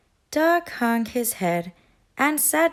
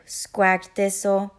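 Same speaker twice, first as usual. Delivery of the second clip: usual speaking voice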